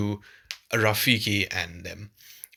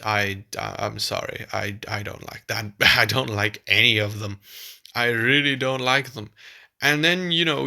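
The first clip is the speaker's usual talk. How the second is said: normal talking voice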